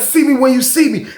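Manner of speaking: high, light smug taunt; a hint of a mocking neighbor. Neighbor repeating his taunt, smug. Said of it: "He was like".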